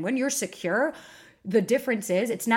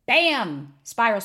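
loud exclamation; 4 semitones higher, 6 dB louder, much slower than usual. Real, not acted. Dramatic punchline of a spiral.

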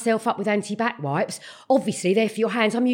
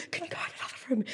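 imitated hushed request; under-the-breath parental request; discreet whisper; 2 semitones higher, 15 dB quieter, much faster than usual. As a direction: whisper it so guests won't hear. Discreet request with friends round.

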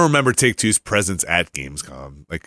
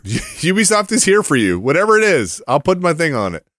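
high mock eager voice; 9 semitones higher than usual. Mocking Ubisoft eagerness.

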